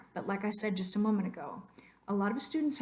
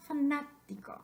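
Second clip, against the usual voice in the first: fanático correctly stressed faNÁtico; fanático with a clear punch on the second a; 6 semitones higher than usual. Shown as a demonstration. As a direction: hit the second syllable of fanático, NA. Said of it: "over that A is where we're getting".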